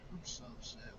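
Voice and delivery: sulky under-the-breath echo; softly repeating how upset he is; muttered upset. Real, not acted.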